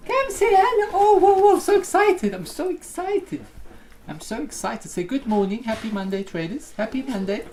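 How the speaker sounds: very high, cooing pet voice. Real, not acted. Greeting the dog on stream.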